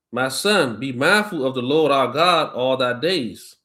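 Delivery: slow, measured Bible reading voice; scripture reading. Acted. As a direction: read it out like a preacher at the lectern. Reads verse five.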